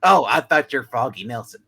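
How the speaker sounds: ordinary talk, nothing marked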